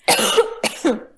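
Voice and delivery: choking cough, swallowed wrong; coughing fit. Real, not acted. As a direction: cough as if a drink went down wrong. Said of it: "j'ai avalé de travers". (toux, avalé de travers)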